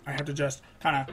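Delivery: usual speaking voice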